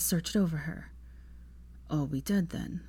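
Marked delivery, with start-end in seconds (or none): 1.9-2.8 faint bewildered question; Draco, weak. A narrator's reading. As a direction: lower and softer, drowsy. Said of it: "he asked".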